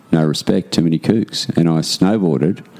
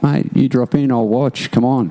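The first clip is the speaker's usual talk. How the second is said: higher-pitched friendly urging; encouraging mate; 7 semitones higher than usual. Retold or imitated. Voicing an encouraging skater to a mate.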